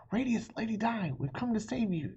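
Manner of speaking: knights calling out high; shouted. Acted. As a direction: rescuers shouting across the clearing. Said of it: "they shouted".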